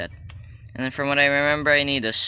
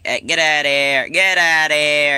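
mock yelling; 3 semitones higher, 7 dB louder than usual. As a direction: holler to drive Granny off. Yelling at Granny while attacking.

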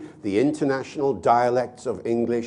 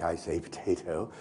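Stephen Fry impression; 5 semitones lower, 10 dB quieter than usual. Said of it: "in a very Stephen Fry-like".

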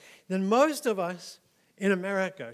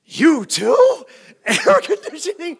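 higher, much louder, laughing fake astonishment; mock amazed co-anchor; 10 semitones higher, 17 dB louder, slower than usual. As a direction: gasp it like it's a miracle. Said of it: "And they're like".

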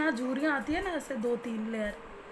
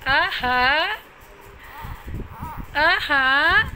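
soothing coo; 4 semitones higher, 7 dB louder, much slower than usual. Real, not acted. Soothing crying baby.

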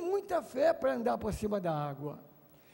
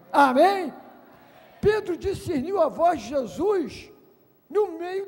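loud fervent preaching; full-force preaching; 10 dB louder than usual. Acted. Preacher promising the congregation Jesus is coming, fervent preaching. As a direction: preach it with fire to the crowd.

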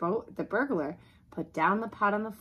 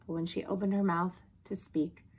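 usual speaking voice

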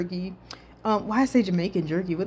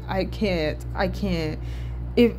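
usual speaking voice

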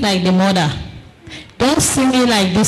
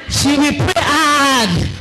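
emphatic shout; 5 semitones higher, slower than usual. Real, not acted. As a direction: declare it hard and slow.

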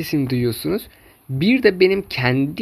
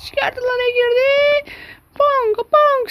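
much higher, hyped-up play-by-play; excited commentator; 3 dB louder than usual. Acted. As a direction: call the match in a high frantic voice. High excited match commentary.

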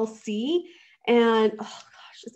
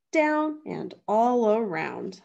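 ordinary delivery, nothing marked

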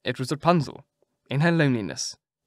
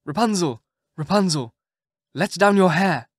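prince calling; 4 semitones higher, 5 dB louder than usual. A narrator's reading. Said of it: "the king's son came and cried".

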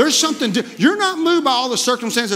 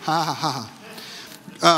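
demonstration laugh; 5 semitones lower, 3 dB quieter than usual. Shown as a demonstration. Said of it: "we gotta learn how to laugh".

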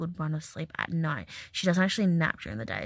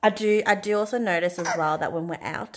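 ordinary delivery

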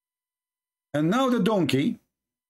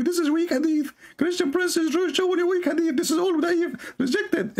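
high mocking voice of his critics; 13 semitones higher than usual. Mocking his critics in a high put-on voice. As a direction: squeak it out like a dismissive critic. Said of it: "they will say to you".

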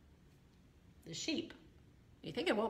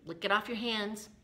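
plain reading voice

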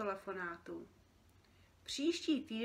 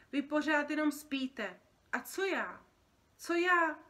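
loud, rising self-pitying protest; aggrieved outburst; 6 semitones higher, 7 dB louder than usual. Acted. Overworked creature to the sleeping boy, aggrieved. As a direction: protest how unfair it is.

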